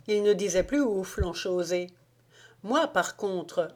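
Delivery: usual speaking voice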